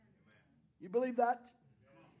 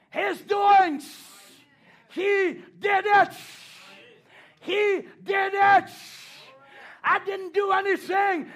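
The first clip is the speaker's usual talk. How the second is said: preaching at full force; 8 semitones higher, 9 dB louder than usual. Acted. Preacher to the congregation, proclaiming God did it. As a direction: hammer each short line like a blow.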